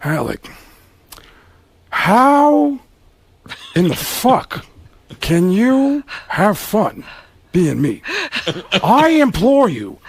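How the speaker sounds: slow theatrical anguish with long pauses; Al Pacino. Acted. Pacino imploring Alec, slow tormented disbelief. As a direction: swoop high on the drawn-out words, then drop. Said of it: "Pacino looked at him and said".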